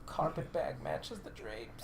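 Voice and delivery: whispered aside. Real, not acted. Naughty joke aside.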